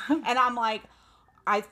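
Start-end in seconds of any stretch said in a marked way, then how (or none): none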